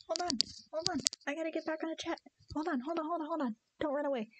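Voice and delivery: high, repeated coaxing to a pet; coaxing pet talk. Real, not acted. Owner holding cat for the chat, high coaxing.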